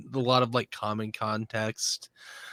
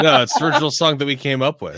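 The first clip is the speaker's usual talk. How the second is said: laughing; 5 semitones higher, 8 dB louder, faster than usual. Pretend. Laughing joke about the band.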